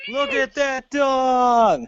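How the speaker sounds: slow mock echo. Mocking echo, slow far.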